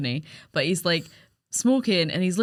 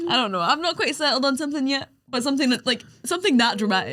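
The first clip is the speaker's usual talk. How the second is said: casual; 7 semitones higher, faster than usual. Retold or imitated. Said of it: "he just says it so casually".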